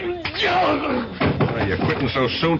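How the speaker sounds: detective mocking the knocked-out eavesdropper; mocking taunt. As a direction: sneer the question slowly.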